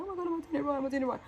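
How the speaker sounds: high, anxious self-questioning; panicky inner voice. Retold or imitated. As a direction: fret like you're unsure. Anxious inner thoughts retold.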